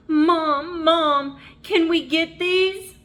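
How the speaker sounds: put-on high, loud begging child voice; pleading child. A narrator's reading. Little rabbit begging his mom. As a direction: plead like an eager little kid. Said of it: "Jasper pleaded".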